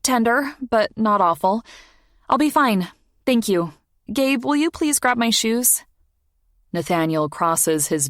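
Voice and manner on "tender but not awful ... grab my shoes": higher, lightly voiced young woman; young woman's voice. A narrator's reading. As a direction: voice her a bit higher and lighter. Female character voice, higher.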